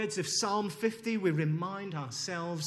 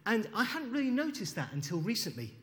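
usual speaking voice

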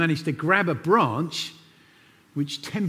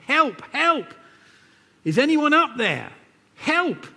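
yelling for help; 12 semitones higher than usual. A narrator's reading. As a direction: call out desperately up the cliff. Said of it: "he began yelling for help".

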